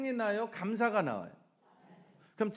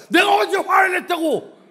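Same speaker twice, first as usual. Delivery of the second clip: high shouted indignant retort; angry denial; 9 semitones higher, 12 dB louder than usual. Acted. Angry person denying any anger in family quarrel, shouting. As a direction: yell back in hammed-up outrage. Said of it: "화를 내는 사람은 자기가 화내는 걸 몰라".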